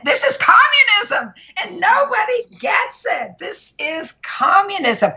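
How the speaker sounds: high-pitched, impassioned outburst; exasperated outcry. Real, not acted. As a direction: cry it out in alarm, pitch leaping up. Ranting that nobody understands.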